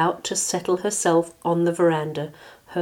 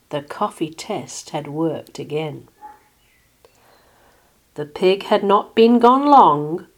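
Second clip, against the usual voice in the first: usual speaking voice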